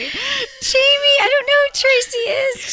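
very high, whiny protest; hammed-up pleading in a high voice; mock-pleading partner. Caught calling partner Tracy, mock-pleading. Playful mock fight over wrong name.

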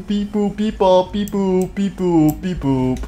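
two-tone spoken beeping; imitated alarm siren. Player voicing the fort's alarm, imitated beeps. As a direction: beep and boop like a siren, winding down.

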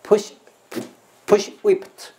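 slow, high "push whipped" with a crisp ending t; final t sounded. Shown as a demonstration. Teacher drilling the ending of "whipped", crisp final t. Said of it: "有一个的在尾音".

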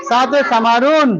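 slow, clearly chanted drill; slow recitation. Shown as a demonstration. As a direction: recite it slowly for the class. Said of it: "বারবার বলো।".

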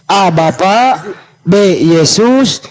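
slow, drawn-out multiple-choice options; slow quiz reading. Acted. Quizzing his opponent like a schoolteacher, slow and deliberate. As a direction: read each option slowly like a teacher.

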